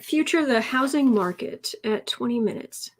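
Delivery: usual speaking voice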